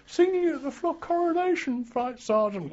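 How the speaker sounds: high, piping earnest reply; imitated recruit. Veteran voicing young recruit answering the sergeant, high and piping. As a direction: squeak out a keen answer.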